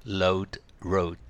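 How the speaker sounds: "road、road" first with L as load, then with R; L versus R. Shown as a demonstration. Teacher demonstrating a minimal pair, L first then R. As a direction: contrast load and road as separate words. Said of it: "LとRの違い".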